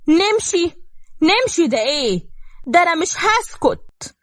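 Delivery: high-pitched indignant protest; outraged. A narrator's reading. Mother refusing her son's plea to leave, outraged.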